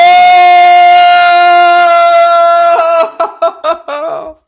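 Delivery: loud, high howl of laughter; huge shrieking laugh. Real, not acted. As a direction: explode into a screaming laugh.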